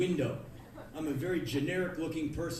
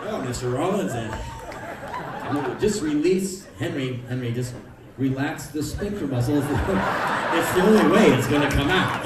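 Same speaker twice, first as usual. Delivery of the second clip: mock doctor; 3 semitones lower, 10 dB louder than usual. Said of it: "at the hospital".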